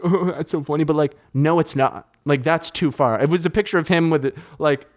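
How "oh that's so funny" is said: higher, mock childish amusement; giggling inner kid. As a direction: imitate a tickled kid after a giggle. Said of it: "the kid in me goes like".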